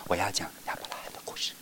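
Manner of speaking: fast soft whisper; teacher whispering the story opening. Shown as a demonstration. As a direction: hush them by whispering. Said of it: "然后我就说".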